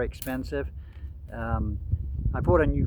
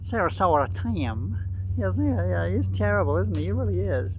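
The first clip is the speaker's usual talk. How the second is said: high mock Southern accent; Miss Vicky; 5 semitones higher than usual. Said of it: "with that southern accent".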